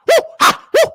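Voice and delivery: whooping woo and ha up high; church-style excited hollering. Real, not acted. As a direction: let out a joyful holler.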